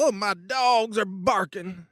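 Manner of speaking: slow, stretched-out Texan caricature; Texas drawl. Shown as a demonstration. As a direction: ham up a Texas drawl, dragging every word. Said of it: "run around with a Texas drawl".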